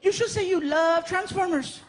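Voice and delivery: put-on high, eager little boy voice; excited kid. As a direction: pitch the idea like a delighted child. [name] voiced.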